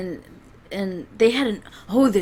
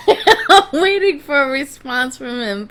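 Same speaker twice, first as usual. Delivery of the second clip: higher, louder, through real laughter; laughing while talking; 4 semitones higher, 6 dB louder than usual. Real, not acted. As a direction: burst out amused, words riding on a laugh. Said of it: "Forgive me for laughing".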